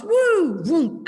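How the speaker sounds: high, loud "Woo, vroom" as the dog bolts; dog escaping out the open door, excited whoop; zooming dog. Retold or imitated. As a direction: burst out like a dog racing through the open door. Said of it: "the dog goes".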